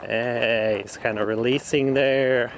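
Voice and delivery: ordinary delivery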